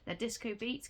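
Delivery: usual speaking voice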